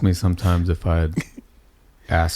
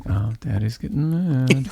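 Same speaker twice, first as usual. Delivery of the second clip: imitating a little daughter; 4 semitones higher, 3 dB quieter than usual. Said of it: "one of them was like".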